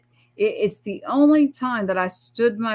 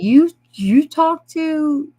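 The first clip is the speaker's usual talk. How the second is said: higher, louder, slow stammered disbelief; stunned disbelief; 8 semitones higher, 7 dB louder, much slower than usual. Retold or imitated. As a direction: stumble over the words in shock. Son stunned by mother's claim.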